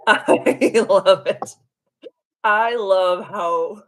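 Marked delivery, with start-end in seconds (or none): 0.1-1.6 slow, drawn out through laughter; laughing. Real, not acted. Streamer enjoying a viewer's cosmic spanking joke, laughing. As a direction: laugh right through the words, stretching them.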